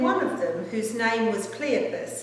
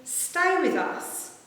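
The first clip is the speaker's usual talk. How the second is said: higher, slow earnest plea; a slow, lifted urging plea; 7 semitones higher, slower than usual. A narrator's reading. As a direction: press him to stay, drawing it out. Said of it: "they urged him strongly".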